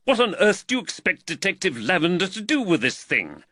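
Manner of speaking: high-pitched, exasperated rebuke; irritated snap. A narrator's reading. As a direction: snap at the constable. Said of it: "Reed snapped".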